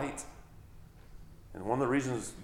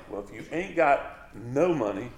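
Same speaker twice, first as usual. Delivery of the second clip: ordinary talk, nothing marked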